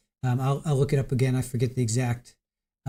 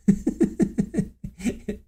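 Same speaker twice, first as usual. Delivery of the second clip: a long breathy giggle; real, long fit of giggles; 6 semitones higher than usual. Real, not acted. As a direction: dissolve into laughter. Laughing after the tagline.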